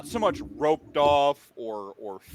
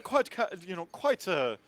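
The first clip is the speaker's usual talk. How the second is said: put-on English accent; 8 semitones higher than usual. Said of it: "from jolly old England".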